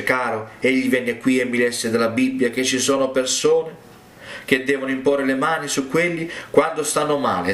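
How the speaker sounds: ordinary talk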